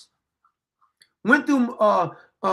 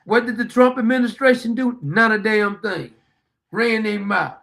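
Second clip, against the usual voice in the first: usual speaking voice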